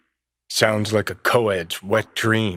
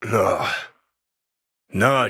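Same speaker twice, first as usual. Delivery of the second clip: normal talking voice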